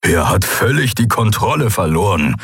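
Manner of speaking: growled verdict; growling. A narrator's reading. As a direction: rumble the words with contempt. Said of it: "grollte Skoru".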